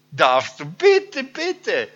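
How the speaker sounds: high, loud, overjoyed begging; can hardly wait to say yes; eager pleading. Acted. Zachäus begging Jesus to come. (Zachäus bittet begeistert und laut)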